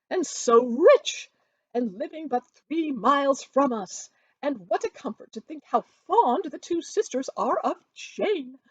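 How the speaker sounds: boasting Mrs Bennet. A narrator's reading. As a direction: crow over the rich match to everyone. Mrs Bennet voiced in story.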